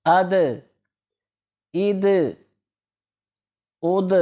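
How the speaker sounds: اد repeated, each د released in a short bounce; qalqala bounce. Shown as a demonstration. Teacher repeating اد for students, bouncing the د. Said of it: "یہاں بھی جنبش پیدا ہوگی".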